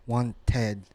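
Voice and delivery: "wanted" split into want-ed; ed as syllable. Shown as a demonstration. Teacher answering which one, "wanted" with ed as syllable. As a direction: give the ed in "wanted" its own full beat. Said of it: "The ed becomes a syllable".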